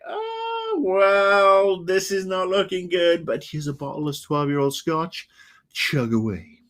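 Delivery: mock doctor. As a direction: play a breezy doctor handing over scotch. Imagined doctor with bad news.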